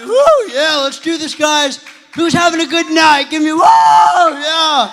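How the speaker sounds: high, loud whooping shout; rowdy, all-out pep rally yell; hyped crowd greeting. Real, not acted. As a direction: fire up the crowd. Speaker hypes up the crowd.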